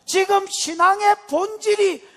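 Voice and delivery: high, slow, loud shout of a frantic father; desperate shout. Acted. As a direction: yell it out as if your son is dying. Voicing the desperate father's outburst.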